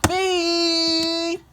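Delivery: high, loud honk noise; car horn beep. Pretend. Kid playing a driver, honking at traffic with a loud beep.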